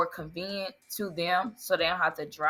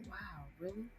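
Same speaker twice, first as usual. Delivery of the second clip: whisper; 17 dB quieter than usual. Retold or imitated. Retelling her reaction to customers.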